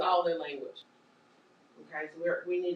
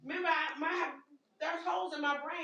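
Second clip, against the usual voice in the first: confused patient; 7 semitones higher, 3 dB quieter than usual. Pretend.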